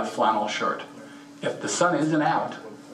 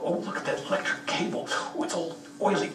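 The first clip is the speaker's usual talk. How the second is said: soft unvoiced whisper, higher at the end; mock marmot voice; 7 semitones higher, 5 dB quieter than usual. Marmots eyeing the oily car cable, soft mock whisper. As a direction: whisper it like a greedy little marmot. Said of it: "they'll come by and they'll go".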